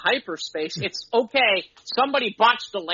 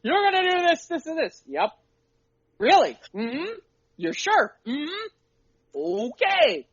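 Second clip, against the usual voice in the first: GM and player back and forth; 8 semitones higher, slower than usual. Retold or imitated. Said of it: "Let me say, say this way".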